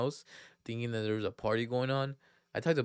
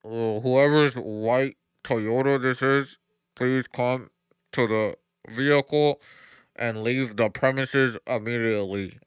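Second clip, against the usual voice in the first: slow, loud tannoy voice ordering the car moved; imitating a security loudspeaker; loudspeaker announcement; 9 dB louder, slower than usual. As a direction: boom it out like a parking lot PA. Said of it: "all of a sudden I just hear".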